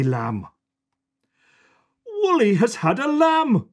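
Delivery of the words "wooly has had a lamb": higher, delighted announcement; pleased surprise. Acted. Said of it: "he says".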